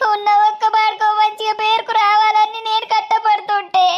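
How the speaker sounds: high, sobbing wail over her fate; wailing lament. Acted. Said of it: "ఎందుకు ఏడ్తానవు?". (ఏడుస్తూ గట్టిగా విలపించడం)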